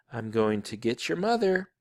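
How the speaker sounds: ordinary delivery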